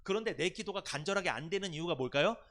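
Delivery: ordinary delivery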